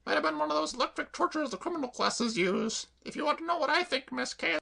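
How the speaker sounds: high, chirpy cockney maid voice; perky maid reassuring her mistress; Claire the maid. Acted. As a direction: pitch her up and pert.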